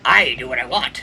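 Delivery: low gruff Wolverine growl. Pretend. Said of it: "This is my Wolverine voice".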